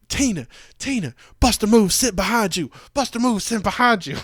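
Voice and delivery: high, loud hammed-up fan voice; mock a giddy lady shooing her friend; excited fan. The fan tells Tina to move, high and hammed-up.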